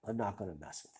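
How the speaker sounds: normal talking voice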